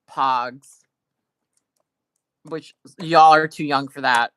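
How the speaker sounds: usual speaking voice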